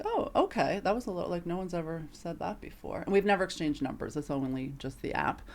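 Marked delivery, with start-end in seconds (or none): 0.0-0.8 high, surprised oh okay; mild surprise in the quote. Retold or imitated. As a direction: caught off guard, answer with a lifted oh. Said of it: "And I was like".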